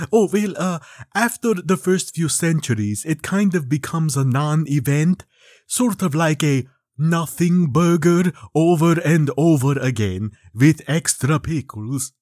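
a hint of a weary immortal, slowed down; lightly played bored vampire; lightly voiced vampire Count. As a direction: shrug off the centuries as the Count. The Count on his birthdays.

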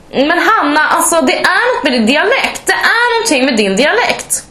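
high-pitched imitation of a prying coworker; nosy colleague. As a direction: play the colleague pouncing on a suspicious dialect. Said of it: "så är det ju min arbetskollega bara".

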